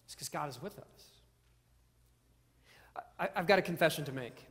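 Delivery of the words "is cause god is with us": hushed, breathy whisper of reassurance; soft slow whisper, drawing it out. Real, not acted. Preacher whispering the promise to the congregation. Repeated line, now whispered.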